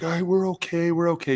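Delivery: high-pitched mock reassurance; anxious quarterback. Quarterback coming over to the linemen, unconvincing high-pitched reassurance. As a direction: imitate a quarterback trying to convince everyone. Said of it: "when the quarterbacks will come over".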